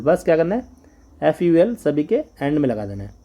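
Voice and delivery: ordinary talk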